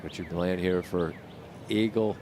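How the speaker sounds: ordinary delivery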